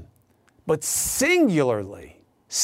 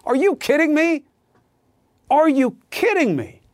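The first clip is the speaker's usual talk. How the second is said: incredulous; 7 semitones higher than usual. Real, not acted. Incredulous at Trump's excuse.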